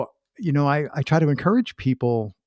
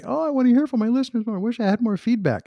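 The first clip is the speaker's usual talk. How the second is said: high, fast mock whine; whiny indie podcaster; 12 semitones higher, faster than usual. Mocking indie podcasters who crave listener feedback, high fast whiny voice. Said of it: "that's not me doing the whiny voice".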